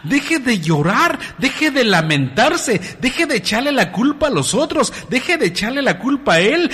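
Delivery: high, loud preacher's plea; pleading sermon at full force, pitched high; fiery preaching. Acted.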